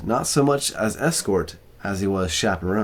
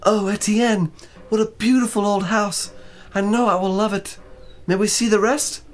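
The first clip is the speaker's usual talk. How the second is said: much higher put-on woman's voice; excited young fiancée; 13 semitones higher than usual. A narrator's reading. Joanna admires the old house to Etienne, excited. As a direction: gush excitedly in a high voice. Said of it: "she spoke to me excitedly".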